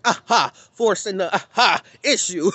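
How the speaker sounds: a light hammed-up preacher aha; mock forced aha. Mocking preachers forcing the issue, hammed up. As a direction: puff the aha like a straining preacher. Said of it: "you can really tell they're".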